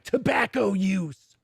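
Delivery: drawn-out deadpan warning voice; mock warning label. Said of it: "Netflix and it's like".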